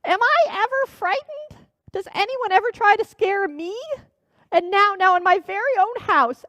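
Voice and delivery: put-on old witch pities herself, near tears; almost crying. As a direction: whine like a hag on the verge of tears. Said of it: "The old witch was almost crying now".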